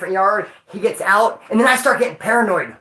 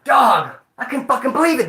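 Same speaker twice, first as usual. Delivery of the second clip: usual speaking voice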